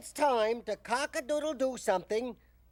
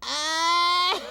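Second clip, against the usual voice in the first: put-on baby frog wail; 7 semitones higher, 5 dB louder than usual. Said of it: "I bet I can make you stop crying".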